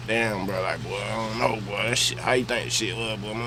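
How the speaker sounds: low, hushed, mumbled quote; quiet. Retold or imitated. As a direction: murmur it low. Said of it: "My cousin asked me some shit like".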